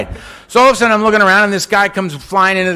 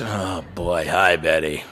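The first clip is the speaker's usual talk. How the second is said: much lower, much softer grudging mumble; reluctant mumble; 9 dB quieter, slower than usual. Retold or imitated. Newcomer grudgingly greets Betty, low reluctant mumble. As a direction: mumble it like you'd rather not be there.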